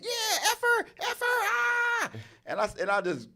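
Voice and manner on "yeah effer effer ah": much higher, strained mock yell; imitating son yelling. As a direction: scream it like a kid losing a match. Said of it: "He just going in".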